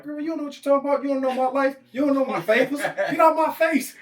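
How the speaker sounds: loud, high mock outrage; hammed-up shouted comeback, high and fast at first; indignant yelling. Imagined addict refusing advice from the coach, indignant yelling.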